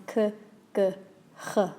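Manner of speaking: "k", "g", "h" each further back in the throat; throat-placed k, g, h; k g h steps. Shown as a demonstration. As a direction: step the sounds down the throat. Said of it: "have a think about these three letters".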